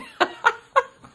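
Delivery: high, loud witchy cackle; evil cackle. Shown as a demonstration. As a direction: cackle like a wicked queen. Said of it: "what I can do with it".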